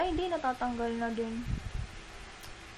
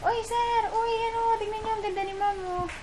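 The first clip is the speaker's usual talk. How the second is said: high excited calling out; excited call to a coworker; 10 semitones higher than usual. Retold or imitated. Clerk calling a coworker over, high and excited. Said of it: "Sabi niya pa".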